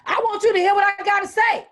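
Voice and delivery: high, loud parent yelling back; angry parent shout. Retold or imitated. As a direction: yell it like an irate parent. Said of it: "irate parent".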